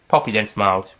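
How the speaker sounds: ordinary delivery, nothing marked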